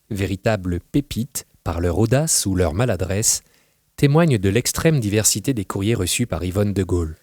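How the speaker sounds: ordinary delivery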